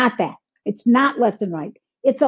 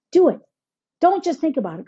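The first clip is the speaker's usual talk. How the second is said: ordinary talk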